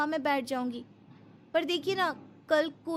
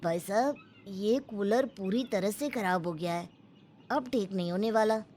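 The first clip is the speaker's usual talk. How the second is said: lower, plain-spoken repairman giving bad news; mechanic's voice; 7 semitones lower, 3 dB quieter than usual. Mechanic telling the husband the cooler is dead, lower voice. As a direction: talk like a tired local mechanic, lower.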